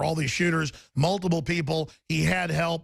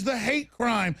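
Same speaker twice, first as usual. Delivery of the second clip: ordinary talk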